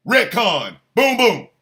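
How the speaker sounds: ordinary delivery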